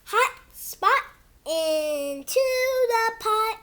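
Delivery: slow, sing-song storybook read; reading to kids. Acted. Kid reading his book to viewers in storybook voice. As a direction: read it like a bedtime book for little ones. Reads picture book aloud.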